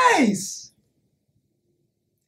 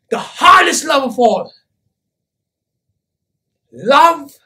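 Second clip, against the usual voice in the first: loud, ringing climax; full-voiced, emphatic sermon; impassioned preaching; 6 dB louder than usual. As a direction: proclaim it forcefully to the whole crowd. Preaching climax about hard love.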